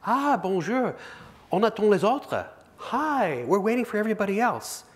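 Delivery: high, chirpy penguin chattering in French. Acted. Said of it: "the other penguin looks back and says".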